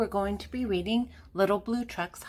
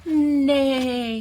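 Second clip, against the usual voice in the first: loud, long, higher spoken neigh; horse neigh; 5 semitones higher, 7 dB louder than usual. A narrator's reading. Horse revealed under the dragon costume, loud neigh. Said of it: "says the horse".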